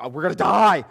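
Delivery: loud cry of acted dread; mock panic. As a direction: wail it like a doomed sailor. Acts out the panicking disciples.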